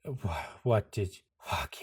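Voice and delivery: soft stammer falling into a whispered curse; stunned cursing. Acted. Anderson realising the villain took his birthday, stunned whisper. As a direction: lose your voice in disbelief.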